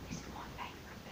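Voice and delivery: hushed whispered aside. Real, not acted. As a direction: lean in and whisper it. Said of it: "aside to listener".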